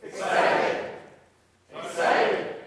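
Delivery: loud, eager group call of the word Excited. Shown as a demonstration. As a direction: shout it out with real enthusiasm. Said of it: "you say excited".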